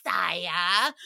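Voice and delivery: low, drawn-out snake impression; cartoon snake. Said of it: "like, the snake, he was like".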